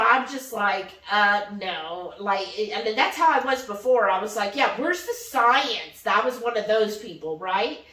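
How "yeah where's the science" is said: ordinary talk, nothing marked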